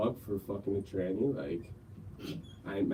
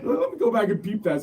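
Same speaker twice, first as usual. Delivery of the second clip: ordinary talk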